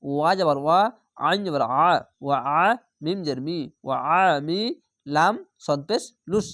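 normal talking voice

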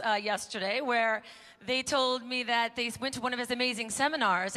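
ordinary delivery

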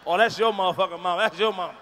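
loud teasing call; teasing kids. Retold or imitated. Classmates teasing a kid about his mama, loud.

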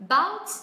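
"about" with the second syllable "bout" swept sharply upward; rising pitch on stressed syllable. Shown as a demonstration. Teacher showing learners the unnatural way, "about" with "bout" rising high. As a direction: lift the pitch steeply through "bout" in "about". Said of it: "thay vì chúng ta cứ lên giọng".

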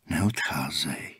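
soft, slow, breathy low statement, no pleading; matter-of-fact. A narrator's reading. Old man calmly tells Dan not to leave, matter-of-fact whisper. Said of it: "bylo to věcné konstatování".

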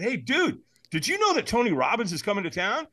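high-pitched, eager phone-call greeting; excited buddy. Retold or imitated. Buddy calling his friend about the event, excited. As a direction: act out a friend bursting with news.

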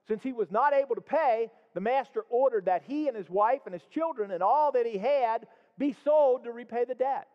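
scripture read aloud with emphasis. Acted. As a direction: read the verses to the congregation. Reads the parable aloud.

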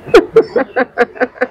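loud burst of laughter. Real, not acted. Interviewer amused by the guest's remark, laughing loudly.

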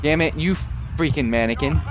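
ordinary talk

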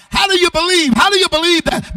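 high, loud preacher's challenge; preaching at full force. Real, not acted. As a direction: hurl the question at the crowd.

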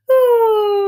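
a high, loud sung ooh gliding down. Shown as a demonstration. Teacher's butterfly path, high voice sliding down. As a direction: float way up, then let it fall to the flower. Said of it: "with your high voice".

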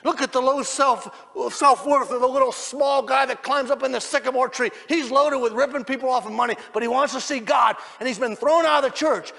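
high, loud impassioned sermon shout; slowing, hammered-out pulpit shout; full-force preaching. Real, not acted. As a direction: preach it at the top of your voice.

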